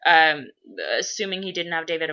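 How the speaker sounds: ordinary delivery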